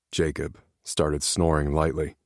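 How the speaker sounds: ordinary talk, nothing marked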